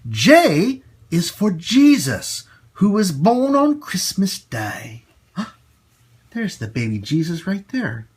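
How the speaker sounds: plain reading voice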